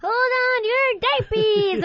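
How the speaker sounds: high, slow baby cartoon voice; squeaky toddler cartoon voice; Tommy Pickles voice. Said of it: "doing lines like".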